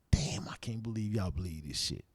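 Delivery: low, scornful whisper. Retold or imitated. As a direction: whisper it so no one hears. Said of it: "I was like".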